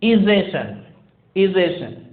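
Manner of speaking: ization with a short "ih" sound, izz-ay-shun; American ization. Shown as a demonstration. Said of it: "is pronounced as".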